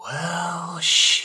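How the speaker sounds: airy drifting mist voice. Pretend. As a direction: speak like a sentient cloud. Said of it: "That's my mist voice".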